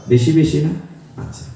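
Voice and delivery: usual speaking voice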